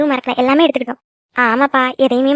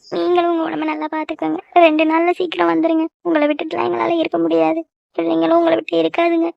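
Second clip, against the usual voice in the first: higher-pitched, tearful parting plea; 4 semitones higher than usual. Acted. Wife begging her husband to come back soon, tearful. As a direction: hold back tears while saying goodbye. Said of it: "இப்ப எதுக்கு அழுதுட்டு இருக்க".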